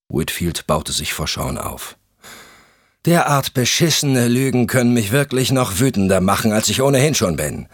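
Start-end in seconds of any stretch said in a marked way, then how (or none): 3.1-7.7 angry husband. Acted. Furious husband confronts investigator.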